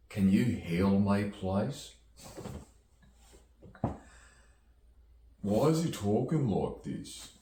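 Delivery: high, put-on silly voice; funny voice. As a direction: pitch it up into a goofy character. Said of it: "Can he do a funny voice, please?".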